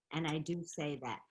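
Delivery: ordinary talk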